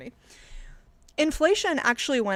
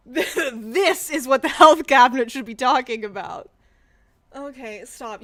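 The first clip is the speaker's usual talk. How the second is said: ordinary talk, nothing marked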